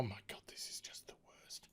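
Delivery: a hopeless aside to yourself; despairing whisper. Real, not acted. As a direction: breathe it out, unvoiced and barely audible.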